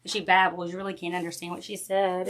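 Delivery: ordinary talk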